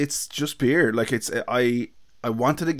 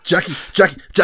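loud, higher mock Kilkenny accent; exaggerated Kilkenny accent, pushed louder; 6 semitones higher, 7 dB louder than usual. Shown as a demonstration. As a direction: repeat the name in hammed-up Kilkenny. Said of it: "here's my best Kilkenny accent".

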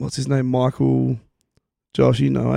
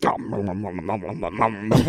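mumbling; 6 semitones lower, 7 dB quieter than usual. Retold or imitated. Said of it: "he's, like, mumbling".

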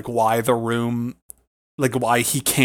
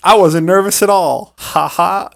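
loud, higher smug boast; cocky mock laugh; 6 semitones higher, 7 dB louder than usual. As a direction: sell a fake-brave boast.

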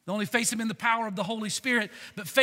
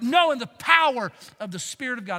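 ordinary delivery

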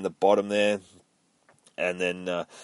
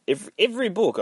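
every jumps high in pitch; every said way up high, then dropped; odd high stress; 11 semitones higher, faster than usual. Real, not acted. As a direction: repeat every in a sudden high squeak. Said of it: "I don't know why I said it like that".

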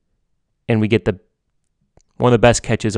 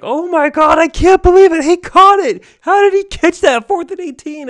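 high, loud disbelieving shriek; screaming in joyful disbelief at a great catch; ecstatic screaming; 9 dB louder than usual. Retold or imitated. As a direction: relive yelling at the TV in total shock. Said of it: "I was screaming".

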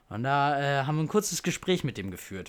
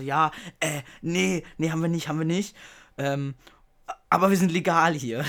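higher, louder, stumbling flustered answer; 5 semitones higher, 7 dB louder than usual. Retold or imitated. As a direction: caught off guard, tripping over words. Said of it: "ich war total durcheinander". (verwirrt und aufgeregt, stockend)

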